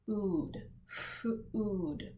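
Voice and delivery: food split into "f" and "ood"; sounded out. Shown as a demonstration. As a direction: stretch the f in food, then a separate ood. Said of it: "The first letter that you hear".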